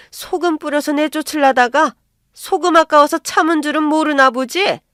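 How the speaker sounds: high, loud indignant scolding; mother-in-law loudly telling off an unwanted visitor; angry taunt. Acted. Mother-in-law threatening to throw her out.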